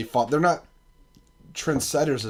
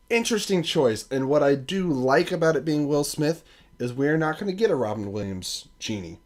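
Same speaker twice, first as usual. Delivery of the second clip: ordinary talk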